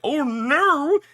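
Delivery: high, loud "everyone panicking" cry; mock freak-out. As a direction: imitate a crowd freaking out, pitch shooting up. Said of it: "everybody's freaking out like".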